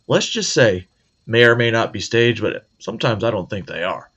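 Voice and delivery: usual speaking voice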